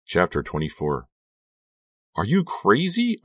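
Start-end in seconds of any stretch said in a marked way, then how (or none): 2.2-3.2 high, incredulous cry; frantic, high-pitched outburst; cried out. A narrator's reading. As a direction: cry out in disbelief. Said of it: "I cried".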